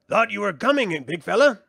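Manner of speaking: high, loud and sassy little brownie; cheeky brownie. Acted. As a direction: greet him like a pert sprite. Brownie Rule greets Willow.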